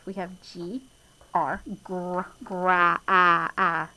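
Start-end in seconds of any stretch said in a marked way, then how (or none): 2.4-3.9 "gra, ah, ah" with the short a sound drawn out and louder. Shown as a demonstration. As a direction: stretch the "ah" in gra so kids hear it. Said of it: "You hear the ah?".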